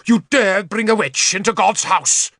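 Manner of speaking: high-pitched outraged cry; screamed outrage. A narrator's reading. Bishop wheeling on Arthur over the witch, high scream. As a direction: shriek it without full volume. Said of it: "he screamed".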